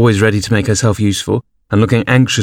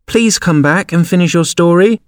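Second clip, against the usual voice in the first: calling after; 9 semitones higher than usual. A narrator's reading. Alice calls after departing Mouse, high and imploring. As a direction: call it after someone leaving. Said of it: "Alice called after it".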